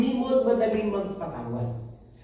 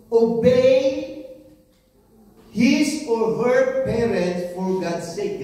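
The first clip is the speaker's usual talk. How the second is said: slow scripture reading; 4 dB louder, much slower than usual. Shown as a demonstration. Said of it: "makikita po natin doon".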